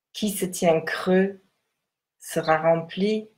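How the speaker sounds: measured, solemn text reading. Acted. Reads the Tao text aloud.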